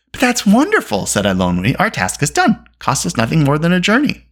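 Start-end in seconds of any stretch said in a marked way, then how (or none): 0.1-1.1 high, excited exclamation; delighted. Acted. Eilonwy hearing the cauldron is gone, delighted. 1.8-4.3 high, eager relief; delighted. Acted. Eilonwy thinking their task is done, delighted.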